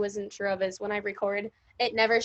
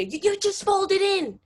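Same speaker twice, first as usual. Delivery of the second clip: heated family shouting; 7 semitones higher, 4 dB louder than usual. Acted.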